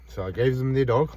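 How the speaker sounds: normal talking voice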